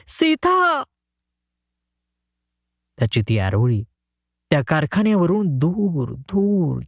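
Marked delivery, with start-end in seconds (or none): 0.1-0.8 very high call with all his strength; desperate shout. A narrator's reading. Abandoned husband calls Sita with all his strength, very high. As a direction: put everything into one cry for Sita. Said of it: "सर्व शक्ती एकवटून हाक मारली".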